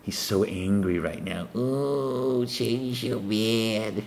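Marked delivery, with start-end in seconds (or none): 1.5-4.0 mock whine. Mocking Satan's complaint.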